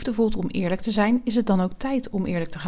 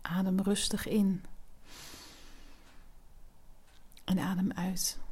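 soft spa whisper; 5 dB quieter than usual. Acted. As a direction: whisper them through each breath. Guided breathing exercise.

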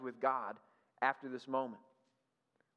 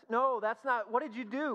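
exasperated parent; 8 semitones higher, 5 dB louder than usual. Retold or imitated.

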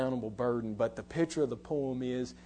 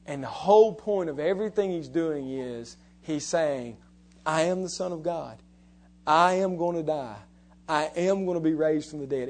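fervent preaching; 3 semitones higher, 5 dB louder than usual. Acted. Preacher voicing Jesus to the congregation, fervent preaching. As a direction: build the claim with fervour.

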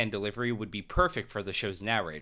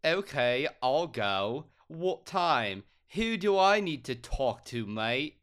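Jonathan Adams, hammed up; 3 semitones higher, slower than usual. Actor agrees to audition, hammed-up British questioning. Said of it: "that's exactly how Jonathan Adams sounds".

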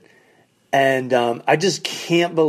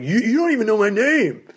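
higher, indignant retort; offended guy; 8 semitones higher than usual. Retold or imitated. Snubbed car dealer telling the comic he doesn't know his name, indignant and higher. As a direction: sound wounded and indignant.

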